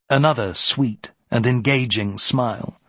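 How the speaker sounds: ordinary delivery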